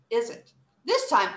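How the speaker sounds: usual speaking voice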